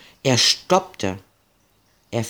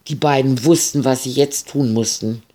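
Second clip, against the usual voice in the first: ordinary delivery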